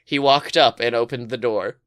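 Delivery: usual speaking voice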